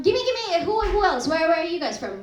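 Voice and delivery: ordinary talk, nothing marked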